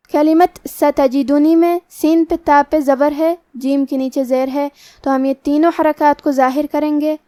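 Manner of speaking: ordinary talk, nothing marked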